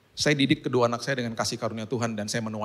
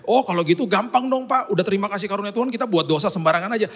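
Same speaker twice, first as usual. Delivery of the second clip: higher smug know-it-all voice; lightly mock a cheeky listener; mocking objector; 9 semitones higher, 4 dB louder than usual. Churchgoer arguing grace permits sin, mildly mocking voice. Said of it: "kalau ada orang ngomong begini".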